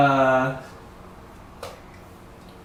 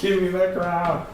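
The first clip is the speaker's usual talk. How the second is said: laughing; 5 semitones higher than usual. Retold or imitated. Echoing the line laughing.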